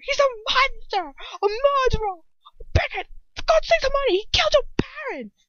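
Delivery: very high, raging outburst; enraged yell. A narrator's reading. Ron listing Lucius's crimes to Hermione, yelling in rage. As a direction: fire off each charge in a rage. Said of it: "he yells, almost rage with anger".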